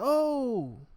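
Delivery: sudden high loud "Oh!"; high startled gasp of a word; shocked cry. Retold or imitated. Victim's shocked reaction acted.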